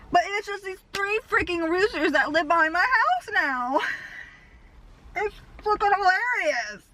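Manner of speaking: high-pitched, incredulous outburst; amused disbelief. Real, not acted. Vlogger finding three roosters behind the house, amused disbelief. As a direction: exclaim it high, tickled and incredulous.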